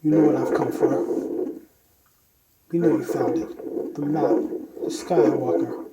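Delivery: menacing masked villain through a modulator; voice changer. Pretend. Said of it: "a little preview of the voice changer".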